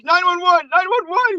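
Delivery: high-pitched panicked repetition; mock panic. Radio host dialing police on an intruder, mock panic. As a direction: imitate a frightened man calling for help.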